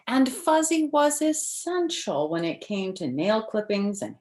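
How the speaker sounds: bright, lively storybook read-aloud; reading to children. Acted. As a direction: read it like story time for little kids. Reads picture book to children.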